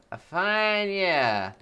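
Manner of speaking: slow, lordly drawn-out voice; posh slow drawl. Acted. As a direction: savour each word like an old aristocrat. Streamer reacts to cheer total.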